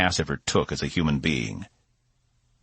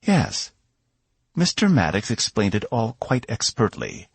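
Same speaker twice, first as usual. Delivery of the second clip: a hint of a higher, confident royal lady; lightly voiced princess; 6 semitones higher than usual. A narrator's reading. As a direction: play the self-assured princess, lightly.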